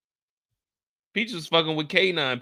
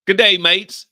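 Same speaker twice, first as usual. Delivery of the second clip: loud, high mock British greeting; a hammed-up British hello; loud hammed-up British accent greeting; 4 semitones higher, 10 dB louder, slower than usual. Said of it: "There you go".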